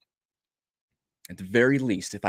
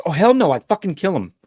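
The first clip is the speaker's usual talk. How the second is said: louder, higher hot retort, swearing; furious outburst; 9 semitones higher, 7 dB louder than usual. Retold or imitated. Protective father to the voice, furious outburst. Immediate angry response retold.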